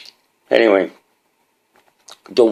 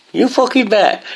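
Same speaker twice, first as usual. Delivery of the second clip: laughing it out, pitch jumping up; cackling agreement; 3 dB louder than usual. Real, not acted. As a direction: answer with a delighted laugh. Answers own question, laughing.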